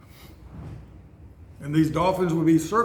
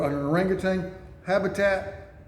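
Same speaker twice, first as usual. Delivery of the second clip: normal talking voice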